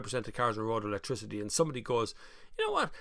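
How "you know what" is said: sudden high mock alarm; mock alarmist. Somebody warning about electricity, mock alarm. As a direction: squeak it like a panicking pundit.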